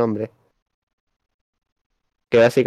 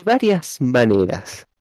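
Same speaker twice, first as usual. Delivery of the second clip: slow, deliberate read-aloud; slow rereading; slower than usual. Shown as a demonstration. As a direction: stretch the words as you read. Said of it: "Volvelo a leer lentamente".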